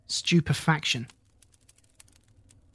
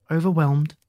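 usual speaking voice

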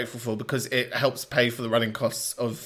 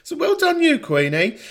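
excited praise; 14 semitones higher, 5 dB louder than usual. Real, not acted. Cheering the caller.

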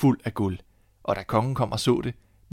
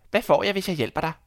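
high sly little man; lightly voiced tricky dwarf; little man's voice; 8 semitones higher, 4 dB louder than usual. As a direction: bargain in a higher little-man voice. Little man bargains again.

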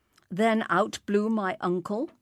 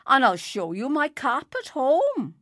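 high, loud insistence; indignant protest; 6 semitones higher, 6 dB louder than usual. A narrator's reading. Stranger offering more proof to Enoch Davis, indignant. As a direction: protest louder and higher.

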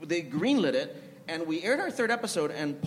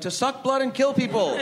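higher, louder mock exasperation; raised-voice retort to someone not getting it; exasperated repeat; 8 semitones higher, 5 dB louder than usual. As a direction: spell out the obvious in comic disbelief. Same answer repeated to obtuse executives.

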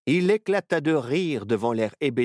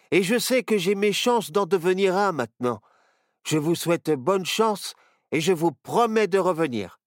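put-on high, loud eager boy voice; grateful boy; 7 semitones higher, 4 dB louder than usual. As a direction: thank him with youthful eagerness. Boy thanks the knight.

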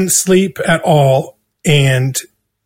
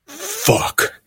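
higher, softer, breathy fuck; whispered fuck; 13 semitones higher, 8 dB quieter than usual. Retold or imitated. Accountant realizing he's tripping, a breathy whispered fuck. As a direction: breathe it out in dismay.

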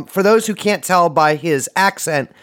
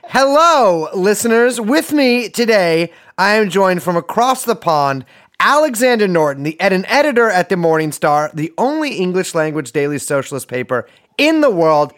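raised, projected presenter voice; show host introduction; 4 semitones higher, 3 dB louder than usual. Acted. Host greets listeners and guest from across the pond, projected presenter voice. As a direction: introduce the guest in a higher hosting voice, settling toward the end.

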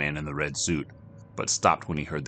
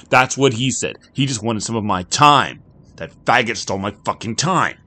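louder and higher, bitter; angry realization; 6 semitones higher, 9 dB louder than usual. Acted. Jack fuming to the bartender, louder and angrier. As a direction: spit it out in disbelief.

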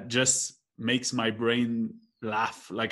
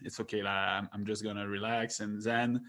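soft, hushed self-soothing, much quieter; forced zen calm; 5 semitones lower, 9 dB quieter than usual. Retold or imitated. Telling himself to calm down after a bad day, in a soft hushed murmur. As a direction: talk yourself down, barely above a murmur. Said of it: "you try to to zen out".

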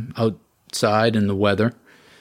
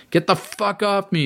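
high desperate scream; 11 semitones higher than usual. A narrator's reading. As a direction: yell at the attacking dogs. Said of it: "he screamed".

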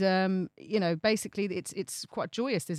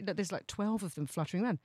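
usual speaking voice

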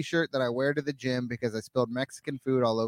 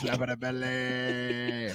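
slow, soft drawn-out echo; slow and quiet; 4 semitones lower, 8 dB quieter, much slower than usual. Acted. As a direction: drag it out softly.

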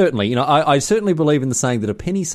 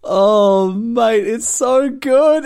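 high, slow and delighted; 11 semitones higher, 4 dB louder, much slower than usual. Real, not acted. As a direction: savour how good it is. Delighted by the story.

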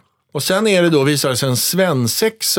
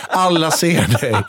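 laughing; 2 semitones higher than usual. Retold or imitated. Laughing at the crouching roadie.

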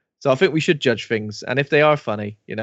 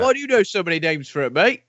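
higher, lightly voiced suspicious cop; mock policeman; 6 semitones higher than usual. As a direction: tease like a nosy copper. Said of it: "He's like, uh".

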